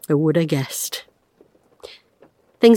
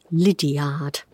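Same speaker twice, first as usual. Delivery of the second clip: plain reading voice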